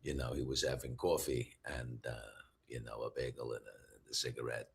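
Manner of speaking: very low, hushed New York guy voice; deep, soft New York character murmur; Maurice from New York. Acted. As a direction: sink to the bottom of your range, quiet New Yorker. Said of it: "I really like talking like Maurice from New York".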